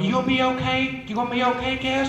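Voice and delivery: lightly voiced anxious mom, asking gently; concerned mom voice. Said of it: "my mom's behind him going".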